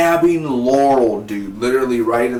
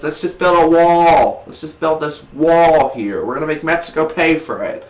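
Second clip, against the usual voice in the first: mock politician; 5 semitones higher, 6 dB louder than usual. Reviewer parodying a wall-building rally, mock bluster. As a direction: stretch it out like a blustering campaign promise.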